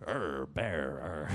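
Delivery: low mock bear growl. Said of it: "You don't growl".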